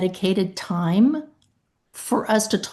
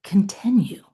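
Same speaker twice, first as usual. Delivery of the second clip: lowered voice; 3 semitones lower, 4 dB quieter than usual. Shown as a demonstration. Adjuster to angry claimant, calm whispered reply. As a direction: drop to a soft, breathy near-whisper. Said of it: "you just lower your voice".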